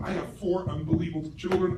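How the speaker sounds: ordinary delivery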